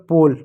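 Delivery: "पोल" with a long O and silent final E; O vowel. Shown as a demonstration. Teacher blending the spelled letters, "पोल" with long O. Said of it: "इसलिए ओ की मात्रा".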